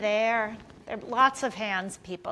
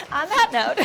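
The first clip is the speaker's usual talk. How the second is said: ordinary talk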